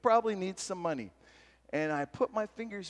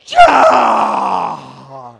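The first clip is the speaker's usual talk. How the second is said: shout; 21 dB louder than usual. Real, not acted. Excited shout to audience.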